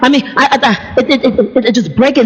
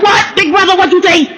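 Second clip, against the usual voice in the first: pitched-up mock growl, picking a fight; a confrontational challenge, voice raised high; 9 semitones higher than usual. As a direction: hit "big brother" like fighting words. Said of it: "take a little bit of the growl out of my throat".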